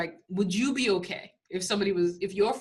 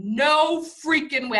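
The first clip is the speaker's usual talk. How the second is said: stunned disbelief; 6 semitones higher, 5 dB louder, slower than usual. Retold or imitated. Reacting to son's Tesla story.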